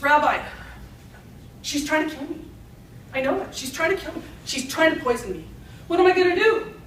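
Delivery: lightly acted plea to a rabbi; worried young husband. Young husband pleads with rabbi in joke.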